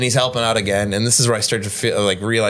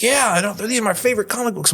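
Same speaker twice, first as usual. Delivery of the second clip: higher, lightly voiced easygoing Greg; breezy, clueless high-pitched Greg; Greg the bar regular; 10 semitones higher than usual. Greg telling his bartender friend, high and breezy.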